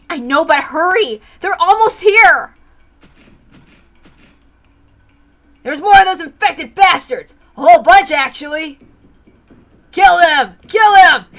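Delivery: loud, urgent yells; urgent shouting. Acted. Mion yells at the attackers climbing up, loud and urgent. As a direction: shout orders over the fight.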